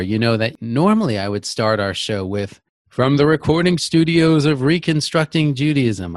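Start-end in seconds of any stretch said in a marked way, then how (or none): 2.9-6.2 big radio announcer read. Shown as a demonstration. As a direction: boom it out like a show announcer. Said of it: "I kinda put on that announcer voice".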